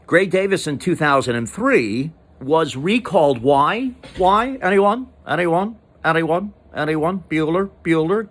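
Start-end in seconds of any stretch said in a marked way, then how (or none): 3.4-8.3 mock teacher roll call. Teacher calling on silent class.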